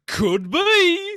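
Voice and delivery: jumps way up into a squeaky falsetto; silly high squeak. Pretend. Co-host answers his own guessing game in a squeaky voice.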